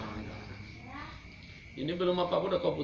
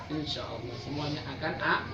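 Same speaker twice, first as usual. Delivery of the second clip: ordinary talk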